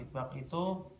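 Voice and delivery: ordinary talk, nothing marked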